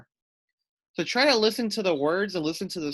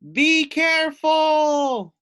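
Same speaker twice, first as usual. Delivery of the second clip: very high, loud, slow barked shout; barked warning; 13 semitones higher, 8 dB louder, slower than usual. Acted. Sealy Sally barks a warning at the travelers. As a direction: bark the warning hard. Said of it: "barked Sealy Sally".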